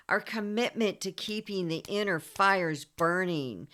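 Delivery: normal talking voice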